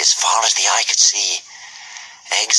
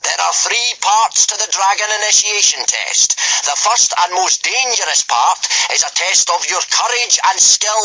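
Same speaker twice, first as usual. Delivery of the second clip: booming Gobber; 11 semitones higher than usual. A narrator's reading. Gobber addressing the boys at full volume, booming. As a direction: boom it out like a hearty Viking teacher.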